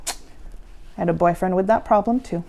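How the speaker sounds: ordinary delivery, nothing marked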